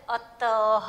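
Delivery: अतः slowly as "a-ta-ha"; slow teacher's model of अतः; sounded final h. Shown as a demonstration. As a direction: stretch अतः and finish on a clear "ha". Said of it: "त के बाद ह बोलना है".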